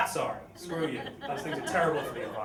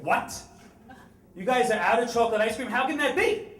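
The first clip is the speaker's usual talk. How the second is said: fast, higher indignant disbelief; outraged customer; 7 semitones higher, 6 dB louder than usual. Retold or imitated. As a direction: play an entitled man in mock outrage.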